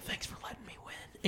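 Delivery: retold soft, voiceless thanks; whisper. Man leaning over to the little girl, whispered.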